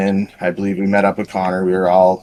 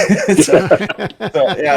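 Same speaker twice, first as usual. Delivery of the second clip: high, breaking up with laughter; amused, laugh-filled restart; real laughing speech; 10 semitones higher, much slower than usual. Real, not acted. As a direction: try to resume through a laugh. Laughing after his own punchline.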